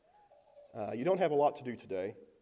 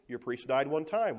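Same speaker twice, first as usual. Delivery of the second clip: ordinary talk, nothing marked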